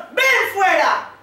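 high, loud shouted command; loud commanding call. Retold or imitated. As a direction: shout it out as a summons. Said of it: "Gritó con todas sus fuerzas".

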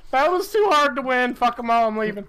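loud, high exasperated outburst; frustrated shout. Real, not acted. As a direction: yell it out in frustration. Rage quitting.

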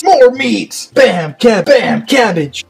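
high punchy chef call; excited exclamation. Pretend. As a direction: slam it down like a TV chef. Chef catchphrase calling toppings.

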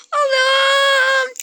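high, loud, tearful "Allah'ım"; weeping with relief; tearful joy. Real, not acted. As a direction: cry out in happy tears. Overjoyed at the good news.

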